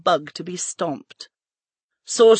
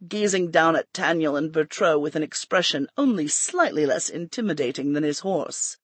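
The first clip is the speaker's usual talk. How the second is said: usual speaking voice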